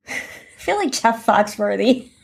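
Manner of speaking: usual speaking voice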